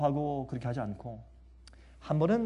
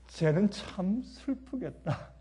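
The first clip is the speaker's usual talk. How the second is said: softer, slow, tender pity; soft mother; 3 semitones higher, 7 dB quieter, much slower than usual. Retold or imitated. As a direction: sigh it gently for the other kid. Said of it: "딱 그 한마디 하시더라고".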